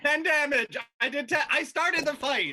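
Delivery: high, loud interjection over the table; insistent shouting. Real, not acted. Player insisting over the others' talk.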